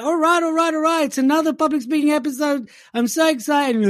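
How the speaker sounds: high, gushing over-the-top enthusiasm; mock excited. Host parodying his own joyous intro, gushing. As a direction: gush like a kid on Christmas. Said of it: "Here I was all joyous and happy".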